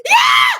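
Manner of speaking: sudden high, loud yelp; real shriek of fright; startled shriek. Real, not acted. Frightened by a moth, startled shriek. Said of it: "That scared me".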